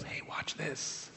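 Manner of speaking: slow, hushed whisper, letting the angels in on it; conspiratorial whisper. Acted. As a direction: whisper it slowly like a secret aside. Jesus whispering aside to angels.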